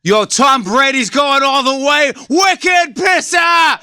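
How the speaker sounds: put-on loud, high, slow thick Boston accent shouting; Boston Sully cheering his Patriots, slow and loud. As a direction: belt it like a Boston sports nut. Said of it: "He would be like".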